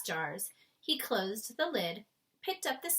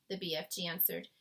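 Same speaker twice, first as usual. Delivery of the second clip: ordinary delivery, nothing marked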